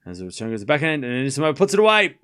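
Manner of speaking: live tennis commentary, rising high on big points; play-by-play call. Acted. Streamer calling the tiebreak for chat, live play-by-play. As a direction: narrate every shot as it lands.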